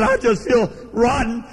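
high-pitched imitation of a distraught woman; retold trembling distress. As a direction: stammer it high and urgent, as if overcome. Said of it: "Tears started coming out of her eyes".

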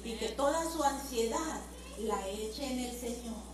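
soft, hushed and reassuring; gentle comforting. Shown as a demonstration. Pastor's wife comforting an anguished sister, soft. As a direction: soothe her, draw her in to pray. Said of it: "No se contesta así".

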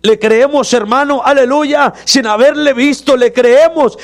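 the preacher's voice swelling at the climax; fervent preaching. Acted. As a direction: lift the congregation up with each phrase.

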